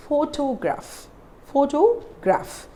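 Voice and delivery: "Photograph" slowly, stressed on PHO; careful model "Photograph", PHO drawn out and stressed; stress on first syllable. Shown as a demonstration. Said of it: "we are stressing on the first syllable".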